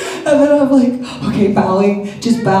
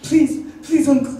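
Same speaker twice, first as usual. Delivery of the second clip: high pleading; 7 semitones higher, much faster than usual. Retold or imitated. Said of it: "I'm like".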